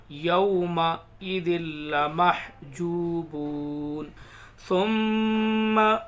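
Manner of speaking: slow, loud, drawn-out tajweed recitation; measured recitation of verses; Quran recitation. Shown as a demonstration. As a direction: chant each word slowly with long pauses. Recitation continues.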